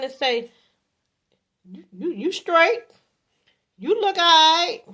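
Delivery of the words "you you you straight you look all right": hesitant, lukewarm approval, pitched up; grudging, noncommittal answer; half-hearted compliment. Acted. Man answering how his woman looks, half-hearted compliment. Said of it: "She don't wanna hear that".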